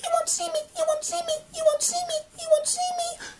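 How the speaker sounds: very high, hushed sing-song "you won't see me"; peep-a-boo squeak of a hiding child; childish hiding voice. Acted. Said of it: "hands over her face and go".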